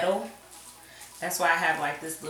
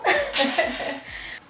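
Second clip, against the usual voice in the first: laugh; 9 semitones higher, 4 dB louder than usual. Real, not acted. Laughs at own messiness.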